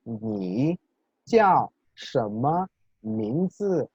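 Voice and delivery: "Ni jiao shenme mingzi" very slowly, each word's tone drawn out; tone demonstration. Shown as a demonstration. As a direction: model "Ni jiao shenme mingzi" word by word, "jiao" falling sharply. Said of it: "interesante combinación de tonos que aparece". ("Ni jiao shenme mingzi" muy lento, marcando cada tono)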